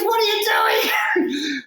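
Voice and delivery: high, stunned disbelief; a shocked friend's high yelp; incredulous. Retold or imitated. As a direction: squeak it out like you can't believe he's still here. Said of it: "He goes".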